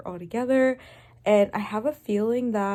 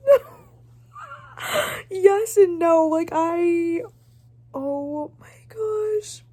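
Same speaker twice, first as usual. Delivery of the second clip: high, wobbling near-crying voice; teary; 9 semitones higher, 5 dB louder, slower than usual. Real, not acted. Reader overcome mid-book, holding back tears. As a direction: voice cracking with emotion. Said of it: "I'm about to cry".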